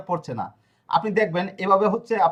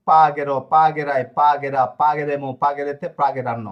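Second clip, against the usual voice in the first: slow, deliberate conjugation drill; slow recitation; 2 semitones lower, 4 dB louder, slower than usual. Shown as a demonstration. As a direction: drill each ending slowly and clearly. Teacher reciting conjugation slowly.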